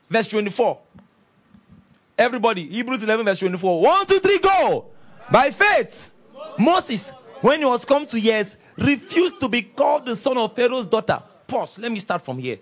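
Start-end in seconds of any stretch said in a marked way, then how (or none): 3.8-4.9 high, loud cue to a crowd; shouted count-in. Real, not acted. Cueing congregation to read together. 5.3-11.2 raised, declamatory group reading; leading scripture reading. Real, not acted. Leads congregation reading scripture.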